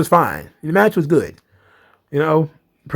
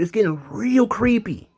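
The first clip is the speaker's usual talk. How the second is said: normal talking voice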